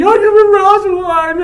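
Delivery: high, loud mock wailing; hammed-up whine; whiny. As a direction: mimic a lovesick whiner, dragging it out. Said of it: "I'll take that over the whiny".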